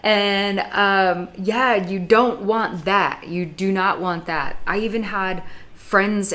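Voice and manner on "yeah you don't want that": ordinary talk, nothing marked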